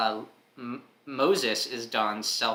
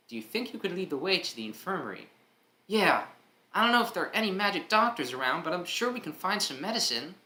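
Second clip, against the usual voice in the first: put-on young boy voice; 8 semitones higher than usual. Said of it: "This child here is named Dot".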